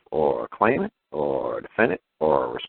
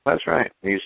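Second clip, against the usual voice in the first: ordinary delivery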